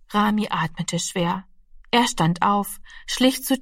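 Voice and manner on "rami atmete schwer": ordinary delivery, nothing marked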